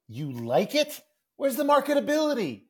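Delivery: high, loud mock dismay; cartoon kid aghast, pitch leaping up; incredulous outburst. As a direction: build from disbelief to a squawked protest. Calvin's outraged reply to his mother.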